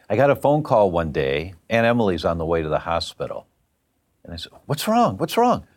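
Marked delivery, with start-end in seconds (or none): 4.7-5.6 high, urgent repeated question; worried questioning. Retold or imitated. Godson asking after aunt rushed to hospital, worried. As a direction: re-enact an alarmed reaction to bad news.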